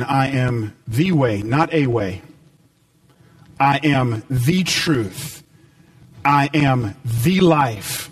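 slow, weighty words with pauses between; slow solemn emphasis. Acted. Preacher voicing Jesus to the congregation, slow and solemn. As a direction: let each I am land, low and slow.

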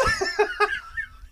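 shrieking laugh; high burst of laughter. Real, not acted. Laughing at drug arrest.

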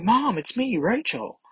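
high, pleading cry to mom; Rachel calling out. Retold or imitated. Rachel calls to her Goblin Queen mom, high pleading call.

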